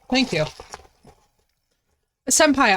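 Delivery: ordinary talk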